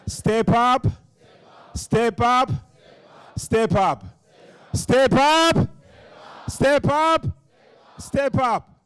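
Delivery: very high, loud crowd chant; urgent high-pitched call to the crowd; rallying shout. Shown as a demonstration.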